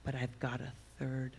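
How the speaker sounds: hushed, confiding secret; conspiratorial. Retold or imitated. Daughter retells her mother's confession in the car, conspiratorial. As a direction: imitate someone softly letting you in on a secret. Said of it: "she was very conspiratorial".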